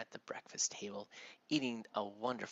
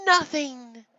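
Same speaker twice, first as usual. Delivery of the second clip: high, loud, breezy confirmation; emphatic sister; 13 dB louder than usual. Acted. Sarah answers her mother, loudly emphatic.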